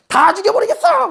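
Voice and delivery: mock furious threat. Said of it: "막 그러잖아".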